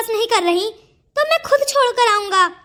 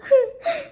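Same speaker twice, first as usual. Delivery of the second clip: teary laugh; 4 semitones higher than usual. Acted. Teary laugh amid crying.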